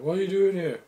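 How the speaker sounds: high, loud startled question; high-pitched, incredulous cadet voice; mock astonishment. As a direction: squeal the question in mock surprise. Acts a cadet questioning Chief.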